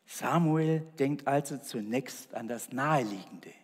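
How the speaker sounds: normal talking voice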